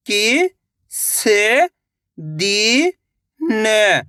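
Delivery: "किस" then "दिन" drawn out very slowly as separate words; sounding out slowly. Shown as a demonstration. Teacher reading slowly word by word to children. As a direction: stretch out "किस" and "दिन" one at a time. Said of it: "एक अक्षर का उच्चारण करके".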